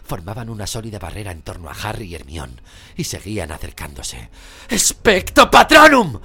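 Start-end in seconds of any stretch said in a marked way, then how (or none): 4.7-6.2 very loud, high, drawn-out yell; shouted spell. A narrator's reading. Harry driving back the dementors, shouting. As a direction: roar the incantation to repel them. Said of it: "gritó Harry".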